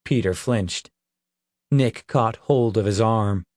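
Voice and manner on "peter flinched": ordinary delivery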